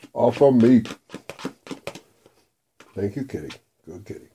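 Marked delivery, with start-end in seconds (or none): 2.9-4.3 much softer, tender praise for the cat. Real, not acted. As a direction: coo at the cat softly.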